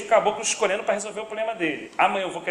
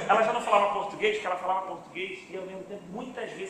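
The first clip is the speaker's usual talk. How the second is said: ordinary talk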